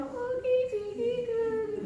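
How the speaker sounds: high, loud cooing nonsense; cutesy baby talk. Shown as a demonstration. Teacher coos nonsense to her mirror self, high and loud. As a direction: coo at yourself like a grandmother to a baby. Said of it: "You can be really gentle, go".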